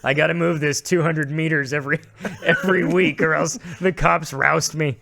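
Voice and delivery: mock homeless complaint. Joking as a homeless person moved on by cops, mock complaint. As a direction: grumble loudly in jest, half laughing.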